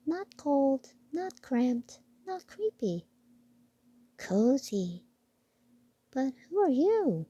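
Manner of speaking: a tiny mouse, pleased then curious; piping mouse questions; squeaky mouse voice. Acted. Mouse character speaks.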